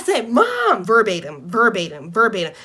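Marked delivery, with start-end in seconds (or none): 0.3-0.8 sudden high yell for mom; scream. Retold or imitated. Daughter screaming for her mom at the window. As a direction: shout for mom across the house. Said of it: "the first thing I do is scream".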